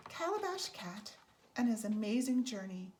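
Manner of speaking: usual speaking voice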